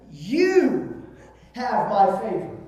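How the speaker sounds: "You" high, loud and drawn out, then a pause; emphatic, soaring "You"; stress on You. Shown as a demonstration. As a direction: punch "You" high as if singling one person out. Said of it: "There's an emphasis there".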